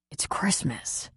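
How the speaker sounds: hushed, slow whisper. Acted. Patty comforting grieving Marie on the phone, whispering. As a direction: breathe it to her gently.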